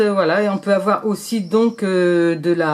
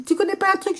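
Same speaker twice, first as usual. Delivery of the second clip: mother asking; 11 semitones higher, faster than usual. Retold or imitated. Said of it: "Elle m'a dit".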